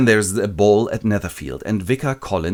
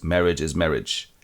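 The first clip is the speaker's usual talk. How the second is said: resigned shrug; 5 semitones lower, 3 dB quieter than usual. Retold or imitated. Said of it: "the friend goes".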